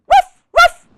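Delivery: high, loud double woof; puppy woof. Acted. Biscuit answering the reader, high loud puppy woofs. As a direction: yip like an eager little puppy. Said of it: "what does Biscuit say?".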